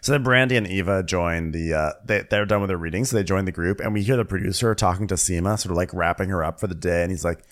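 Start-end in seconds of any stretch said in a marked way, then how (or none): none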